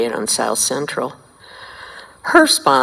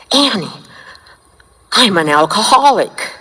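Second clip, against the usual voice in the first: excited revelation; 5 semitones higher, 4 dB louder than usual. Retold or imitated. Breathless revelation to mentor.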